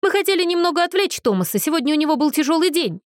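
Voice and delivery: high, rapid shouted explanation; calling out. A narrator's reading. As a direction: hurriedly call an excuse through the door. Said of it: "крикнул Мэтью".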